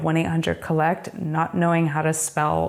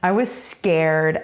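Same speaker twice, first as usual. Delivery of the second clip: ordinary delivery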